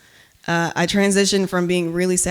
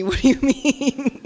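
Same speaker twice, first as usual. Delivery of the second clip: slow, laughing question; laughing disbelief; 6 semitones higher, 3 dB louder, slower than usual. Retold or imitated. Poet retelling her answer to people who say she yells, laughing. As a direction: ask it through a laugh, baffled. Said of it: "I was just like".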